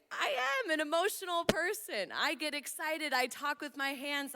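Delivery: high, loud burst of self-defence; hammed-up emotional outburst; loud and excited. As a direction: proclaim it at full, excited volume. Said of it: "I talk loud sometimes".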